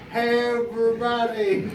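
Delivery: high, loud tipsy hello; drunk camera greeting. Retold or imitated. Drinker greets the camera in France, over-excited.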